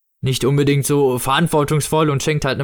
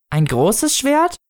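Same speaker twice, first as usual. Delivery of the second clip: put-on slow, high child voice, rising; hopeful child; 12 semitones higher, much slower than usual. Child misunderstanding the prompt for thanks, high questioning voice. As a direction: answer tentatively with a high questioning lift.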